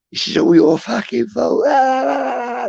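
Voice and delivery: imitated angry tirade, very high-pitched; mock screechy blame ending in a high wail; having a go. Girl blames him for the fight, having a proper go. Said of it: "having a proper go at me".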